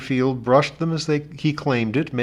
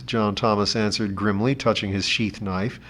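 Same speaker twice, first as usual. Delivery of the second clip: ordinary delivery, nothing marked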